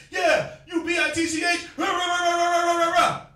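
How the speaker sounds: ranting raging man, loud and high; fly into a shouting rage; mock angry yell. Preacher mimics a raging man, mock furious shouting. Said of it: "as soon as he's like".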